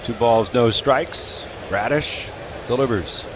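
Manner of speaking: usual speaking voice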